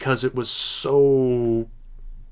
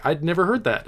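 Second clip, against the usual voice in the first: ordinary talk, nothing marked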